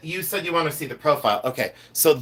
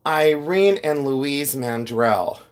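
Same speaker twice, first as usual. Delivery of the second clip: ordinary delivery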